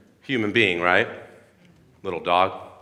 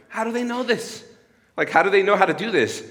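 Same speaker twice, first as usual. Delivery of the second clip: amazed question; 8 semitones higher than usual. Retold or imitated. Amazed at kids' meditation poses.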